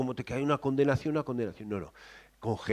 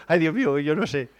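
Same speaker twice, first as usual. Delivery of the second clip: normal talking voice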